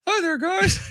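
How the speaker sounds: high squeaky puppet voice, lips still; ventriloquist dummy voice. Pretend. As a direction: talk like a dummy on his lap. Puppet voice again, imagined postgame.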